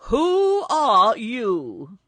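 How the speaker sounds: slow, booming challenge; loud, drawn-out demand; loud calling out. A narrator's reading. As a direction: call loudly across a distance, stretching each word. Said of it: "called out in a loud voice".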